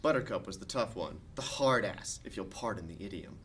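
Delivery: ordinary delivery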